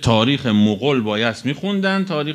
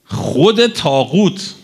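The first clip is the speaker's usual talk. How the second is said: very slow, contemptuous; slow, cutting scorn; scornful emphasis; 3 semitones higher, much slower than usual. Real, not acted. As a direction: drag out the insult. Scornful naming after long pause.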